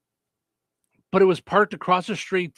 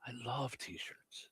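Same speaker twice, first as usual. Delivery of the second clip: whispered aside; 6 semitones lower, 21 dB quieter, much slower than usual. Real, not acted. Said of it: "And also, why?".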